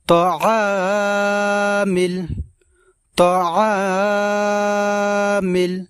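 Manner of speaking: তাআমিল with the আ held long and slow; slow teaching drawl on তাআমিল; long drawn vowel. Shown as a demonstration. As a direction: stretch the আ in তাআমিল. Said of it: "এবং এখানে আমরা টান দেব".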